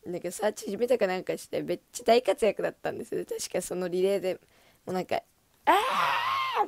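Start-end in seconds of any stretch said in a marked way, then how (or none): 5.7-6.7 excited shout. Retold or imitated. Excited cry at relay.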